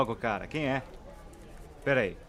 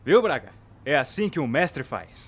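loud, slow, smug showing off; smug boast; 7 dB louder, slower than usual. Acted. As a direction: gloat slowly. Boasting to colleague.

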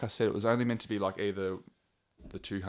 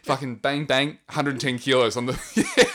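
laughing; 5 semitones higher, 7 dB louder, slower than usual. Real, not acted. Laughing while telling lift.

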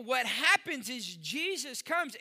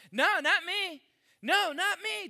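high, loud, defensive no; frantic denial; 7 semitones higher, 5 dB louder than usual. Acted. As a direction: push the accusation away fast. Peter denying he knows Jesus.

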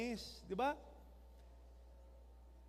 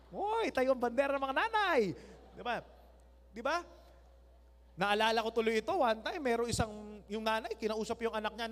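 normal talking voice

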